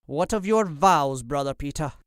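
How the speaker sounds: mock the monk with a sneer. A narrator's reading. Noble taunting a false monk, sneering. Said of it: "Lord Percy sneered".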